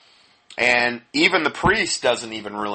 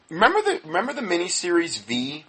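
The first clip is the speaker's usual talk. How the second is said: excited high question; 8 semitones higher than usual. Real, not acted. Eager rhetorical question to listeners.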